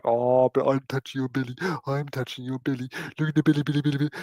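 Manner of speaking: cooing pet-teasing voice; sing-song, babbling taunt to a cat; playful teasing. Acted. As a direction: tease a pet in silly baby talk. Teasing cat while touching belly.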